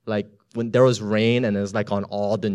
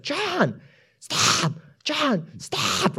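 very high call, urgent; 10 semitones higher, much slower than usual. Retold or imitated. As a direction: cry out high for him to stop. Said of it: "I was like".

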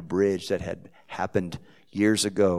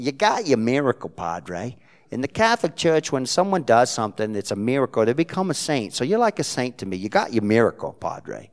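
loud, high New Jersey woman explaining sainthood; emphatic New Jersey accent, pressing her point; insistent Jersey accent; 5 semitones higher, 7 dB louder than usual. Retold or imitated. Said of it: "in her broken Jersey accent".

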